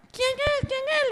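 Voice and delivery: put-on very high, tiny kid voices; little goats asking. Little goats calling through the door in tiny high voices.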